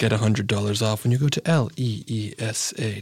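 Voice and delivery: low, soft ad voice, slowing at the end; hushed sign-off. Pretend. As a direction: let it sink down and slow to a close.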